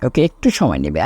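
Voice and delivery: usual speaking voice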